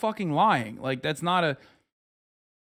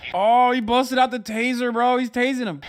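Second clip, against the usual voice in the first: higher, louder shocked reaction; excited reaction; 8 semitones higher, 5 dB louder than usual. Real, not acted. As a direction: react in surprise. Streamer reacting to taser.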